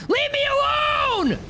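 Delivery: high stretched scream of fright; panicked shout. Real, not acted. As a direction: shriek as the monster hits you. Monster attacks, shout and scream.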